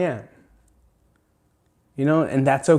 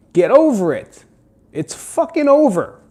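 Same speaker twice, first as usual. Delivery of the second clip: ordinary talk, nothing marked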